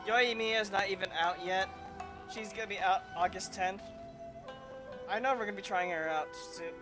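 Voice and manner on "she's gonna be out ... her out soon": usual speaking voice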